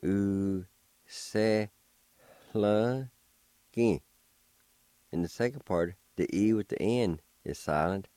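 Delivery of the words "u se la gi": "U, se, la, gi" each held separately, faint H before the L; slow syllables with pauses between. Shown as a demonstration. Teacher showing the first part of the word, one syllable at a time. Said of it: "It's pronounced like this".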